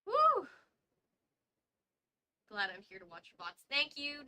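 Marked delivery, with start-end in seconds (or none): 0.0-0.5 high, loud happy whoop; excited woo. Real, not acted. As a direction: cheer it out loud. Cheering at the reading break.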